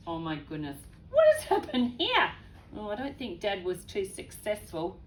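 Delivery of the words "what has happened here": high, loud surprised question; shocked. Real, not acted. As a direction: react to the disaster. Reader reacts again.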